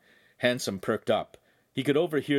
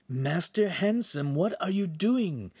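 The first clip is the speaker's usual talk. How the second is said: high, slow, drawn-out exasperation; exasperated; 9 semitones higher, slower than usual. A narrator's reading. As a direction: scold the master in disbelief, stretching the words. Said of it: "Pan whispered in an exasperated tone".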